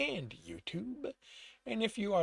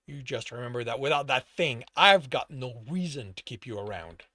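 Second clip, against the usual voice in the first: lower-pitched threat; menacing warning; 6 semitones lower, 4 dB louder than usual. A narrator's reading. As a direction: warn an underling coldly. Boss threatens glared-at underling.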